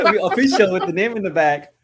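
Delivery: laughing through the words; amused chuckle while speaking. Real, not acted. Old newspaper memories, laughing together.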